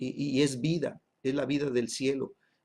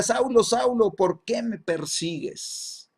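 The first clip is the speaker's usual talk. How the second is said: calling out; 8 semitones higher, slower than usual. Retold or imitated. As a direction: voice from heaven calling out, then drawn-out question. Heavenly voice calls Saul by name.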